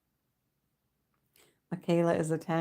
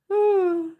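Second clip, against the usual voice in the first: high whimpering sigh; 14 semitones higher, 5 dB louder than usual. Real, not acted.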